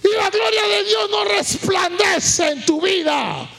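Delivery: high, loud shouted sermon, phrases drawn out at the end; full-force preaching. Real, not acted. Pastor warns the congregation about being dominated by problems, full-force preaching. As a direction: cry it out to a whole congregation.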